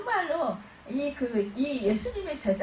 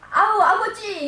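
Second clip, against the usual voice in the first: high, loud spontaneous outcry; imitated heartfelt shout; acted cry to the Father; 9 semitones higher, 9 dB louder than usual. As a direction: call out to Father God urgently. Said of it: "이 소리가 나온다라는 거예요".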